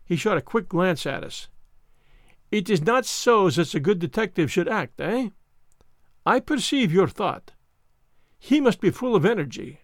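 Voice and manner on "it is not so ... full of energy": put-on French-accented detective, pointed and emphatic; Poirot, mocking lecture. Poirot reads the detectives' thoughts, mockingly emphatic, French-accented.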